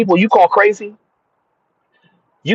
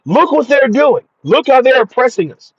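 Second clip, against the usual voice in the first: high-pitched imitation of complainers; put-on high voice of protest; mock complaint; 7 semitones higher than usual. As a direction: quote the complainers in a raised pitch. Repeats the complainers' imagined words.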